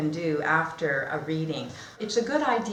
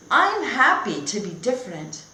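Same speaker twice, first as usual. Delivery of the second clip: Alfred's thought; 4 semitones higher, 6 dB louder than usual. Acted. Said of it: "He thinks".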